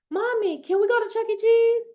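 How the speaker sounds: put-on high, pleading little girl; a hint of a small child begging; high child's voice asking for Chuck E. Cheese; little daughter asking. Voicing her small daughter.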